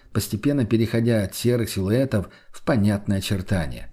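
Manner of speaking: ordinary delivery, nothing marked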